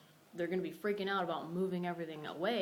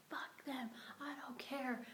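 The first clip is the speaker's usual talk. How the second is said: retold scream; 8 semitones higher, 5 dB quieter, slower than usual. Woman retelling her outburst at the park, muted mock scream. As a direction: imitate screaming with the volume held down. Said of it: "I was screaming".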